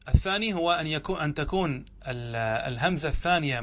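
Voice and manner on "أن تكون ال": ordinary delivery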